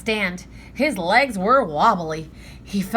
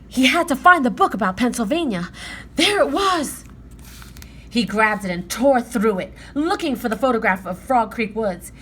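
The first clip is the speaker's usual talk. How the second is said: plain reading voice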